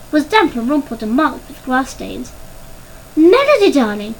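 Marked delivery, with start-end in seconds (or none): 3.1-4.1 high, loud cry dropping on the endearment; mother exclaiming in concern; anxious cry. A narrator's reading. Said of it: "cried Missus Parker".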